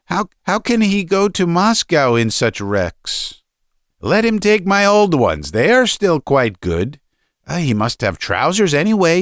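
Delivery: high, hesitant mother urging her husband; fretting mother, stumbling into her appeal; pleading mother. Acted.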